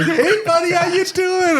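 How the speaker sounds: very high, squeaky-sweet greeting; self-mocking, cooing hello; gushing buddy greeting. Boss greeting his late employee, self-mocking gushing. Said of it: "and I'm like".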